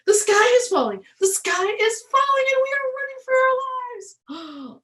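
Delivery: high, loud, fast panicked cry; panicked and breathless. A narrator's reading. Chicken Little running in panic, crying the sky falls. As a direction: shriek the alarm while out of breath. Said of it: "who was now quite out of breath".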